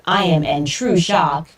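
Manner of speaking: ordinary talk, nothing marked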